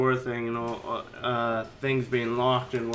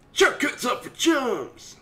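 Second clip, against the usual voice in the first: mock shout; 9 semitones higher than usual. Player mocking the shortcut, hammed-up shout. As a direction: bellow it like a cheesy hero.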